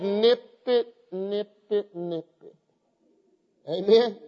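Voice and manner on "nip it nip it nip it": nip it fading softer each time; a lightly voiced nervous deputy, trailing off; Barney Fife impression. Preacher quoting Barney Fife to the church, voice fading away. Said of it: "Barney Fife said".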